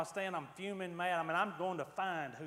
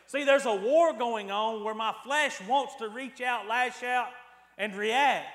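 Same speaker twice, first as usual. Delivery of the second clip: louder and higher, driving the sermon home; preaching at full force; 7 semitones higher, 9 dB louder than usual. Acted. Preacher to congregation about flesh and spirit, at full force. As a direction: preach it hard, climbing with each line.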